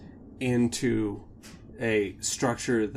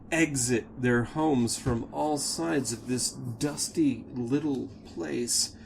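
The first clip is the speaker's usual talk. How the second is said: ordinary talk, nothing marked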